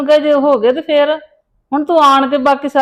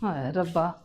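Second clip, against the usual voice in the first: despairing sigh; 7 semitones lower, 13 dB quieter than usual. Acted. Locked in, lamenting alone.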